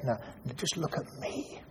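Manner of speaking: whispered softly, face to face; hushed, gentle plea. Acted. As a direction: breathe it, tenderly. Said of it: "gets hold of her face very gently and says".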